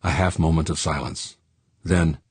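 plain reading voice

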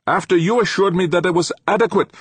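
high, loud professor firing back; sharp retort. A narrator's reading. Professor throwing blame back at his engineer, indignant retort. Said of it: "Ulrich retorted".

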